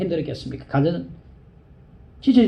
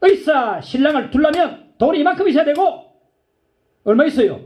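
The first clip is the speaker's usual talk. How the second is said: raised, dismissive bargaining; haughty parents; 10 semitones higher, 3 dB louder than usual. Retold or imitated. Doctor's parents belittle the bride's mother, raised and dismissive. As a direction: look down on the poor family. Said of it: "그 부모가 하는 말이".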